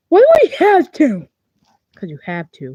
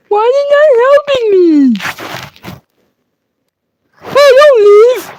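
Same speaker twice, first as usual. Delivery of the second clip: mock desperate shout; 9 semitones higher, 5 dB louder than usual. Shouting after the player who is leaving him, high and desperate. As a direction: yell after them not to leave.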